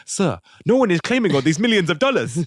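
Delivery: put-on foreign-accented employee protesting upward; Robert Azizu. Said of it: "Robert Azizu going to his boss".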